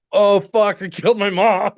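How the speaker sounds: loud, high mock panic; imitated horrified realization. Daughter seeing bloody glass in her hand, mock panic. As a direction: blurt it high and loud, hammed-up.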